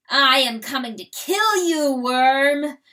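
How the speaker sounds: loud defiant challenge; battle cry. Acted. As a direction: roar a challenge at the foe.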